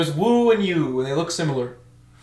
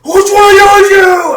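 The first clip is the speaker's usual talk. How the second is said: full-volume high-pitched scream; screaming drill instructor; 16 dB louder than usual. Retold or imitated. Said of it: "He's screaming, right?".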